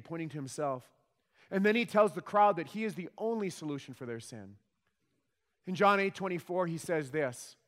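ordinary delivery

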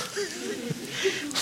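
high laugh, breaking into giggles; real amused laugh. Real, not acted. Laughs at the oddity.

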